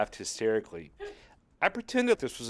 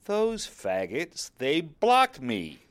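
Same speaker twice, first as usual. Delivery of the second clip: mock outrage; 5 semitones higher, 4 dB louder, much slower than usual. Mock outrage at being blocked.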